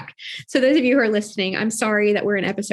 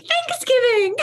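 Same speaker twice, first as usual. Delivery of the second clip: very high, silly outburst; playful high exclamation; slower than usual. Acted. Cohost jokes about friend's odd sound, playful high exclamation. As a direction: squeal it out in a high voice.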